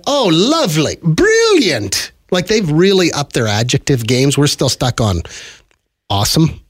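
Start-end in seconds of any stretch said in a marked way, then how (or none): 0.1-2.1 high, swooping English exclamations; mock English gushing. Host imitating Londoners who love their adjectives, mock English gushing. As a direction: gush in a raised, put-on English voice. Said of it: "they love their adjectives over there".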